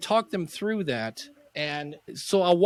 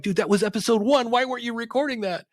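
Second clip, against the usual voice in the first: higher, louder, half-joking outburst; exasperated disbelief; 8 semitones higher, 4 dB louder than usual. Retold or imitated. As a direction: throw your hands up at your friend. Retold exasperated push to client.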